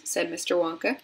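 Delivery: usual speaking voice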